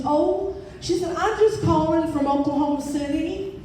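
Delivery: lightly sketch a folksy Oklahoma caller; Aunt Bee twang. Retold or imitated. The caller from Oklahoma City, with a light Southern twang. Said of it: "She sounded like Aunt Bee".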